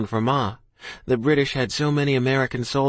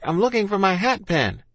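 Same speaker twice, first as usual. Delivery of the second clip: high, mildly raised call; restrained, pitched-up shout; shouted back; 7 semitones higher than usual. A narrator's reading. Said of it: "I shouted back".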